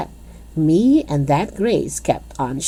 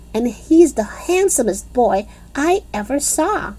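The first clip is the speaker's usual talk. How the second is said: put-on indignant girl; 7 semitones higher than usual. A narrator's reading. Lucille huffily telling the girls the boy is new and handsome. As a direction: set them straight, fuming. Lucille angrily corrects them.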